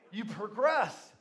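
normal talking voice

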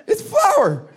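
very high, loud innocent answer; cheerful wife voice. Retold or imitated. Wife tells husband it's flour, very high.

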